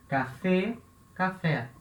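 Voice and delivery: ordinary talk, nothing marked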